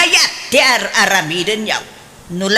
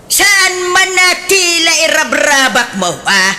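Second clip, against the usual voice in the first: high, loud scolding mother; raised-voice scolding; 7 semitones higher, 4 dB louder than usual. Acted. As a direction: scold her daughter sharply. Mother scolds daughter over boyfriend.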